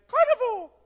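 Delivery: very high, loud carnival cry; hammed-up high-pitched celebration; festive whoop. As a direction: call it out like a party shout.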